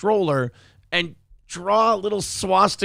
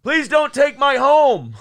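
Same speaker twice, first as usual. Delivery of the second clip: pleading; 7 semitones higher, 6 dB louder, slower than usual. Retold or imitated. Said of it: "Yeah, but it's usually".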